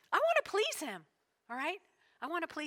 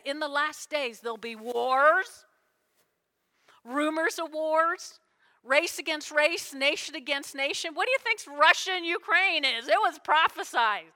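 loud, higher fervent preaching; 4 semitones higher, 8 dB louder than usual. Acted. Pastor warns the congregation about the last days, loud and fervent. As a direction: build it up and drive it at the pews. Preaching end-times prophecy.

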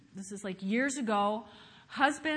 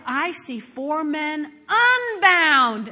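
loud amazed cry, last word drawn out; shouted; 3 semitones higher, 9 dB louder, much slower than usual. A narrator's reading. King seeing four men in the furnace, shouted. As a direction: proclaim it slowly like a stunned king. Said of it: "Nebuchadnezzar shouted".

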